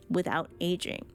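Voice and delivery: ordinary talk, nothing marked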